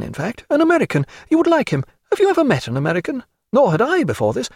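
high, animated society woman; lively Diana, chattering. Acted. Diana chattering to Stephen about the American, high and animated. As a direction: rattle on in a high feminine voice.

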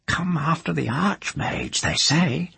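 put-on high, cracked old crone gossiping; nosy old village witch. A narrator's reading. Old witch Moss speaking.

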